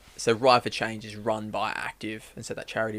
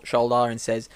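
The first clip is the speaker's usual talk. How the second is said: ordinary talk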